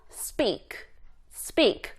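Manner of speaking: "speak" with hard, unaspirated p; tense the p after s in "speak". Shown as a demonstration. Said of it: "이렇게 된소리가 난다".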